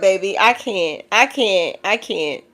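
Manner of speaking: normal talking voice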